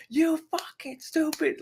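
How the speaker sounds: high, strained scream held down in volume; mock scream. Cook screaming at the waitress over bar music, strained mock scream. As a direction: imitate screaming without the full volume. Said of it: "we can hear him screaming at her".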